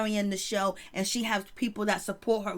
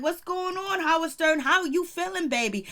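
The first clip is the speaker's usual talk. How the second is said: high-pitched, sing-out greeting to a star; teasing, high-pitched hello; playful mock check-in; 7 semitones higher than usual. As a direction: call out a teasing how-are-you, pitched up. Playful mock check-in with Howard Stern.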